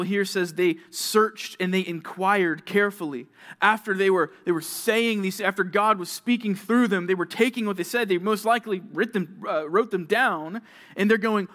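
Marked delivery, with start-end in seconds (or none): none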